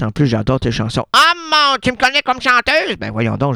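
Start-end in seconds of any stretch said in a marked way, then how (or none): 1.1-3.0 [name]. Acted. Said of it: "Oui, mais Georgette".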